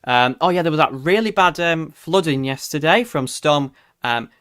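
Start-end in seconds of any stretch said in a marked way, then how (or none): none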